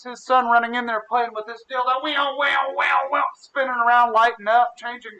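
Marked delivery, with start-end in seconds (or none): 2.0-3.2 higher, swooping whirring noise; imitated lightsaber hum. Kid running round with makeshift lightsaber, imitated whirring. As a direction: swing each one like a toy lightsaber.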